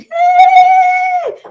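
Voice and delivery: very high, loud whoop; festive cheer. Acted. Crowd cheers the new king, loud high whoop. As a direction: shout it like a reveller at a festival.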